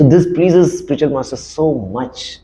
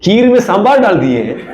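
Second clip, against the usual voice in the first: high, laughing outrage; mock outrage through laughter; laughing mock indignation; 9 semitones higher, 4 dB louder than usual. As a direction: huff through a laugh, pitched up. Mocking retelling of mistake.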